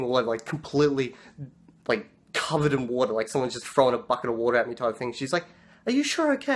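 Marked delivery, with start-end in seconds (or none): 5.9-6.5 high-pitched imitation of a French shopkeeper; foreign shopkeeper. Shopkeeper checking on the sweating rider, high mock French voice. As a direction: put on a high, concerned shopkeeper voice.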